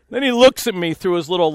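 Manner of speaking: normal talking voice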